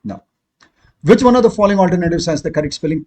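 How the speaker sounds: usual speaking voice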